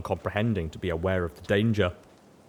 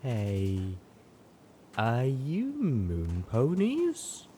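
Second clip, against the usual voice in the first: strung-out voice; 3 semitones higher, much slower than usual. A narrator's reading. Same strung-out mare.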